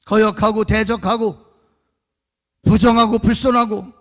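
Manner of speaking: loud, ringing sermon bursts; fiery Korean pulpit preaching; preaching at full force. Acted. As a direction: thunder each phrase at the congregation.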